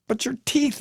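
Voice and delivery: high, startled outburst; blurted. A narrator's reading. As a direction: blurt it out, astonished. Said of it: "I blurt".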